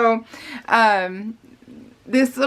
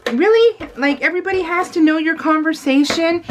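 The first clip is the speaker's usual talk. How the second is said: high, louder indignant disbelief; higher-pitched mock outrage; incredulous reaction; 7 semitones higher, 3 dB louder than usual. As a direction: act out outraged disbelief at rude callers. Acted indignant reaction to callers.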